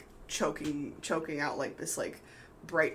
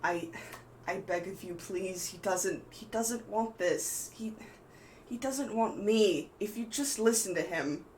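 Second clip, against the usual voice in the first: lightly voiced pleading, rising higher; 4 semitones higher than usual. Eutoris begs Ruth not to force the marriage, lightly voiced plea. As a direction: beg desperately, a touch higher. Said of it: "almost pleading out".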